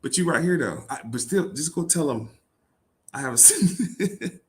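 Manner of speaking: high, laughing mockery of the line; mock it as absurd, laughing; mocking disbelief. Real, not acted. Mocking contradiction in scripture.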